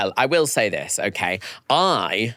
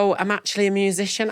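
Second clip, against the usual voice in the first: a light, fast, high quote; quick and modest; 9 semitones higher, faster than usual. Retold or imitated. Retelling [name]'s reply, lightly voiced and quick. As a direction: brush it off quickly. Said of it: "she said".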